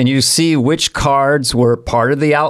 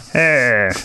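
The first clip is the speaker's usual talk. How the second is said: Judd Apatow impression. Shown as a demonstration. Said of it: "I'm gonna try Judd Apatow".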